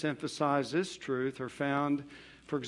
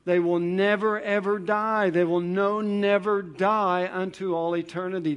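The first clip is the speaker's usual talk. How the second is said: never, ever pushed loud and a bit higher; emphatic never; 4 semitones higher, 6 dB louder than usual. Real, not acted. Pastor driving home Christ's promise, loud and emphatic. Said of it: "It's very emphatic".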